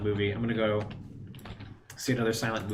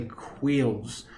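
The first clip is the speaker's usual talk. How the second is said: put-on slow, careful French accent; much slower than usual. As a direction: read the French line slowly in a French accent. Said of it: "my French accent".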